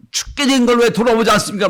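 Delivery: high loud indignant yell; scolding shout. Retold or imitated. Frightened disciples scold Jesus in the storm, loud shout. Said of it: "막 호통을 치잖아요".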